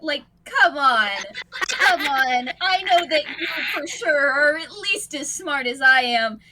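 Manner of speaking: loud, pleading exasperation; exasperated guardian. Acted. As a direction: plead with the kid, rising up. Kaito lectures Umi.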